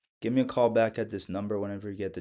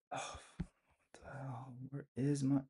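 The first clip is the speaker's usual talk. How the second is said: hushed, breathy what the hell; whispered; 3 semitones higher, 13 dB quieter than usual. Real, not acted. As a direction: whisper it under your breath. Call suddenly drops, muttering.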